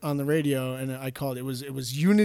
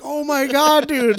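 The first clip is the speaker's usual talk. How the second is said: much higher and louder, caught off guard; shocked Oh my God; 12 semitones higher, 6 dB louder, slower than usual. Retold or imitated. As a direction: realise your buddy just cursed live on air. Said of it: "And I'm like".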